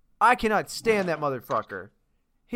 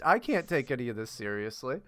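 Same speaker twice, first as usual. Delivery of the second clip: ordinary delivery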